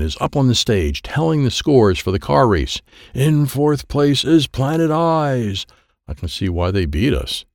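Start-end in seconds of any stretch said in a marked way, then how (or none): 3.1-5.6 higher, slow, drawn-out results call; announcer. Acted. Driver Doubleman on stage reading the race scores, announcing. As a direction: announce it grandly to a crowd.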